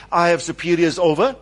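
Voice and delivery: ordinary talk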